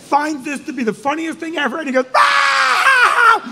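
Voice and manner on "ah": loud high-pitched howl; long piercing cry of disbelief; hysterical shriek. Retold or imitated. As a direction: shriek with helpless hilarity. Barry bursts out at the news.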